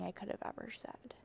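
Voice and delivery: whispered aside; sheepish whispered afterthought. Real, not acted. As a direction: drop to a whisper for a confession. Joking aside after her freestyle.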